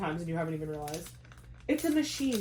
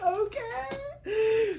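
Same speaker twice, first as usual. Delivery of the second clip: teary okay. Retold or imitated. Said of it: "And I was like".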